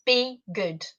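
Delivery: ordinary talk, nothing marked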